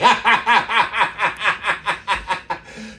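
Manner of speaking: cracking up at the story; big laugh. Real, not acted. Laughing after the punchline.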